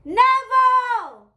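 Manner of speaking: long, high drawn-out call across the street. A narrator's reading. Boy calling his friend's name down the block, long loud call. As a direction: stretch the name out loud and high to reach him. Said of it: "and called out".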